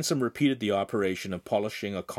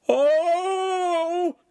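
long, very high, loud squealed "oh"; 7 dB louder than usual. A narrator's reading. The pale enactor about to burst, squealing. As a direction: let out a piercing wail. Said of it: "the now pale enactor squealed".

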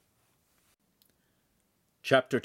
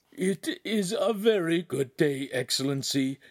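whimper; 7 semitones higher than usual. A narrator's reading. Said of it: "Agostino said in a whimper".

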